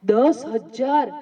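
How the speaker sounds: slow prompting repeat; slow, drawn-out repeat. Shown as a demonstration. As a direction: draw the number out again, slowly. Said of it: "જોરથી બોલો, જોરથી".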